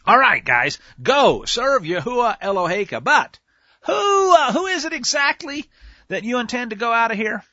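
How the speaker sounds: loud, wavering Pharaoh pleading; nervous Pharaoh. Acted. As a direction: voice Pharaoh cracking as he bargains. Pharaoh voiced, nervously bargaining.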